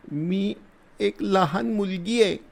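put-on high, soft small-girl voice; a little girl answering a soldier timidly. A narrator's reading. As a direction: shrink into a scared child's answer. Frightened girl answers softly.

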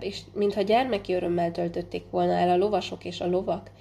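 ordinary delivery, nothing marked

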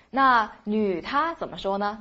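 normal talking voice

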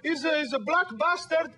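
put-on dad's thick Italian accent. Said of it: "thick Italian accent".

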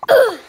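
loud, high ugh as if hit; pained grunt. Acted. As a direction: grunt from the blow. Hit by thrown food.

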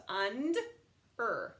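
und, er split into two parts; und, then er; sounded out. Shown as a demonstration. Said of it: "peel off the ending".

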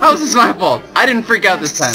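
indignant protest. Real, not acted. Player denying blame to his friend, high and indignant. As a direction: push back hard against the blame.

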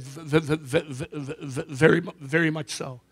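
a job seeker stammering out his answer; stuttering. Acted. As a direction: stammer on the A before absolutely. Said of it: "a speech impediment".

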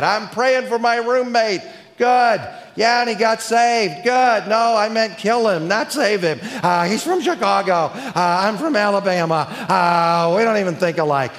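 high, eager student voice; mock student voice. A preacher mimicking his student, high and eager.

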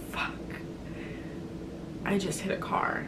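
very soft, deflated mutter; hushed dismay. Retold or imitated. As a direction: retell the sinking realisation under your breath. Retells dazed reaction to hitting car.

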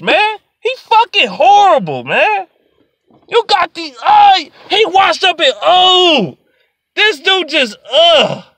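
high-pitched exasperated ranting; fed-up, strained outburst; disgusted. Real, not acted. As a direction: wail in frustration, breaking off mid-thought. Angry rant about a bad player.

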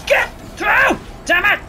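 frantic high shout; straining shout. Real, not acted. Player forcing way through enemies.

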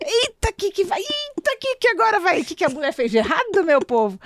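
playfully panicked, asking what went wrong; alarmed exclamation. Retold or imitated. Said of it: "Eu falei". (espanto exagerado e brincalhão)

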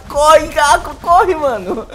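high, urgent urging; urgent panic. Real, not acted. Player urging the dinosaur Caco to run, panicked. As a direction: egg your runner on in a panic.

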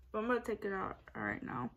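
lips pulled apart; talking while stretching lips; fingers in mouth. Real, not acted. Seen 1: Fingers hold lips apart, showing braces, throughout the labelled words.

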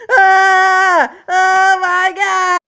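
high, loud drawn-out yelp; startled scream. Real, not acted. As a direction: cry out in sudden fright.

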